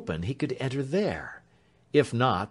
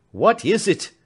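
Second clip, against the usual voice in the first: much higher and louder, stress on is, falling; anguished cry; 10 semitones higher, 9 dB louder than usual. A narrator's reading. Alfred cries out to the crowd, anguished. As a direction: cry out in agony. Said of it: "cried Alfred".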